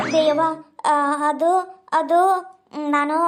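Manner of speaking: nervous, halting start; afraid to tell. Acted. Daughter working up to confess to her mother, hesitant and afraid. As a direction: hesitate and stall before a confession. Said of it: "ಅಷ್ಟ್ ಯಾಕೆ ಹೆದರ್ತೀಯ".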